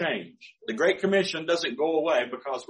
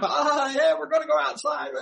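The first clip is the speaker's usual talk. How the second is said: high, eager voice of a dog; excited dog voice; 11 semitones higher than usual. Pretend. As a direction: yip it out like a dog dying to go out. Voicing excited dogs at door.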